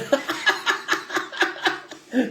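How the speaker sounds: high unvoiced laughter burst. Shown as a demonstration. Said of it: "des rires simulés".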